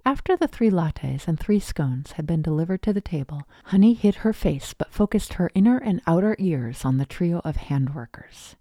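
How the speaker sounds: usual speaking voice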